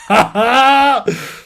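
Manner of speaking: loud, high mock cackle; villain laugh. Reader mocks the suitor as a schemer, cackling.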